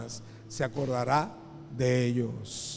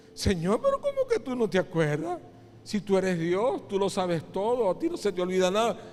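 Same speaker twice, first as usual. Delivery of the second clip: high, insistent acted disbelief; incredulous protest; 6 semitones higher than usual. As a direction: plead with God in baffled protest. Sinner protests God forgot his sin. (protesta incrédula y aguda)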